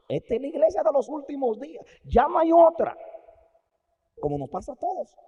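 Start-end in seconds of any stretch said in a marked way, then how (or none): 0.1-3.0 high, loud proud proclamation; proud boast. Acted. Preacher voicing Wesley boasting about his church. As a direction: crow it like a proud founder.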